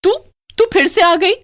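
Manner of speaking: high, loud fright; shocked fear. Acted. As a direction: gasp it in terror. Witch appears again. (डरी हुई चीख)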